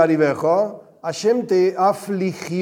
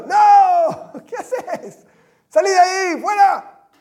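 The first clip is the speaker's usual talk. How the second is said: high, loud panicked yell of No and Fuera; mother's shout; 13 semitones higher, 4 dB louder, slower than usual. Retold or imitated. Mother yells at her child near the socket, high alarmed shout. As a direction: scream at the kid to get away from the socket. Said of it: "la madre lo ve y le grita".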